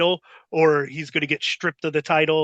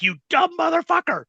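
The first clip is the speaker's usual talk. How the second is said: higher mock scream; retold scream; 11 semitones higher than usual. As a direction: imitate him screaming the insult. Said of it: "screaming at me".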